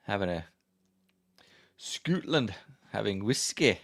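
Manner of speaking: playful put-on Scottish accent. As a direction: do a mock Scottish accent, word by word. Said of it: "my Scottish accent".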